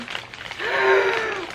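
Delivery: sharp high gasp of delight; excited gasp. Real, not acted. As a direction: gasp in thrilled surprise. Unboxing wanted books.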